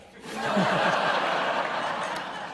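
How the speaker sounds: high breathless chuckle; delighted laugh. Real, not acted. Recalling the boss's amazement, a delighted laugh. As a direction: laugh with pleasure.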